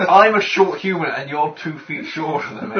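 loud, laughing protest. Real, not acted. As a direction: blurt it out through laughter.

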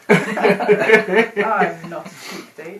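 slow, drawn-out laughing denial; laughing protest. Real, not acted.

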